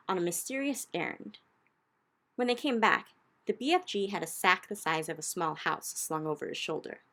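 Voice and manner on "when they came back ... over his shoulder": ordinary delivery, nothing marked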